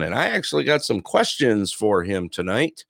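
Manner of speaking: normal talking voice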